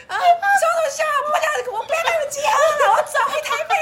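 high, loud crying shout; tearful shouting. Retold or imitated. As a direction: scream it through sobs. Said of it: "大声的怒骂". (哭着大声怒吼)

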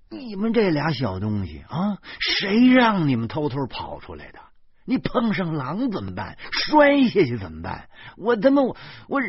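loud, rising scold that breaks off; telling off runaway kids; 怒不可遏地训斥; furious scolding. Acted. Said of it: "我怒不可遏地说".

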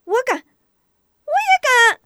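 put-on high, loud, slow children shouting; eager kids. Children volunteer eagerly, high loud shouting. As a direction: everyone eager not to be left out.

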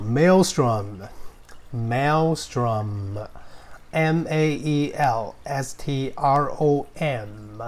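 Maelstrom said slowly, then spelled letter by letter; slow spelled demo. Shown as a demonstration. English teacher slowly spelling Maelstrom for students. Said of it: "我们先来看第一个字".

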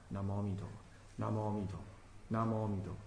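low, very soft Buddha-name chant; hushed, low devotional murmur; slow settled recitation. Shown as a demonstration. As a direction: recite inwardly, barely voiced, letting the mind settle. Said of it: "轻轻的提着".

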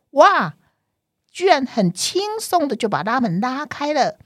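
a bright, high gasp of surprise; surprised. A narrator's reading. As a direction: sound amazed the door opened. Surprise at the door opening.

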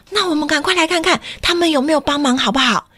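ordinary delivery

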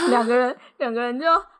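loud, fast, high laughter; laughing. Retold or imitated. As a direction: burst out laughing. Recounts awkward laughing.

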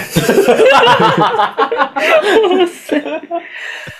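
long high-pitched burst of laughter. Real, not acted. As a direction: break into a long shrieking laugh.

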